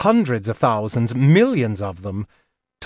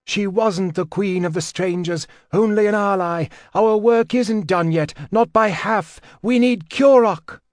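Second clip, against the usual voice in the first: higher, rising to louder insistence; urgent Dorian; 7 semitones higher, 3 dB louder than usual. A narrator's reading. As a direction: press harder with each phrase. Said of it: "Dorian's voice".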